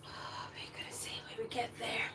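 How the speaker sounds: sighed hushed whisper. Real, not acted. Vlogger whispering to viewers, sighing. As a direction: breathe it out as a tired whisper.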